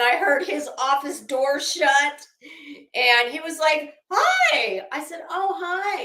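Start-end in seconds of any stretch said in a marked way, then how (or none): 4.1-4.8 imitated "hi", pitched way up; high-pitched greeting. Imitating husband greeting her at night, high-pitched. 5.3-6.0 high-pitched reply. Retold or imitated. Retold own reply to husband.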